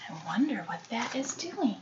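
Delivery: whisper. Real, not acted. Reader wondering aloud to the child, hushed whisper. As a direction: lean in and whisper it.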